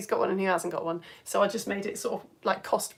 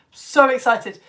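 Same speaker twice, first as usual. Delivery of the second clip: louder, emphatic repeat; excited; 3 semitones higher, 8 dB louder, slower than usual. Real, not acted. Telling viewers about seeing the new film, emphatic excitement. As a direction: lean hard into the thrill. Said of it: "I'm so excited".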